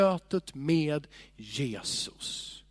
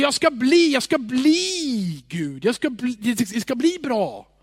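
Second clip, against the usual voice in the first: mock hypothetical God; 7 semitones higher, 7 dB louder, slower than usual. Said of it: "Gud säger inte om sig".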